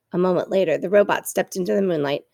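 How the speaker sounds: plain reading voice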